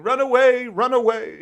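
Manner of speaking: high, loud panicked cry of run away; Monty Python run away. Retold or imitated. Quoting the Monty Python knights, a high panicked run away. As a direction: yelp it like fleeing knights in a comedy sketch. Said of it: "the Monty Python guys saying".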